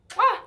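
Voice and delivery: high little "mwah" kiss; quick smacked farewell kiss; blown kiss. Real, not acted. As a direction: sign off with a kiss sound. Blows kiss signing off.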